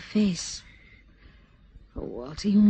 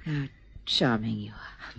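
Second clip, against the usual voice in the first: breathy whisper; 5 semitones lower, 5 dB quieter than usual. Acted. Intimate reply to his confession.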